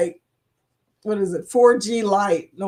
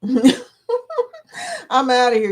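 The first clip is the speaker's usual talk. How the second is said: laughing slowly; 4 semitones higher, 3 dB louder, much slower than usual. Real, not acted. Laughing sign-off.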